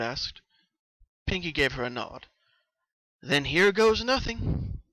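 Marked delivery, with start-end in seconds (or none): 3.2-4.8 slow, higher, steeling for the spell; a nervous here-goes, drawn out; bracing herself. Acted. Twilight bracing before touching Pinkie's mind, slow and higher. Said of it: "Twilight said, taking a deep breath".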